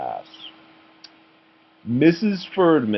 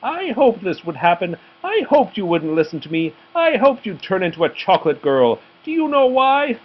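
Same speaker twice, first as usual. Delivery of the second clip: high, gleeful villain teacher; sinister teacher; 8 semitones higher, 3 dB louder than usual. A narrator's reading. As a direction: savour the evil secret.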